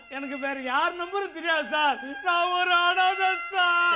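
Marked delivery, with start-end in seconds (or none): 2.2-4.0 hammed-up pitiful plea, slow; overacted orphan self-pity; fake sob story. Contestant fakes sorrow to the quiz host, hammed-up pitiful whine. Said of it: "எப்படி நடிக்கிறான் பாரு".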